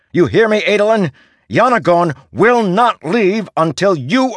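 high, insistent, building to a strained shout; heated urging. A narrator's reading. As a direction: berate a wounded comrade into getting up. Berating wounded man into getting up.

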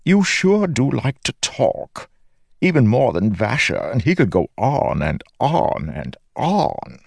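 a chatty, teasing living sword. Acted. Sword keeps talking.

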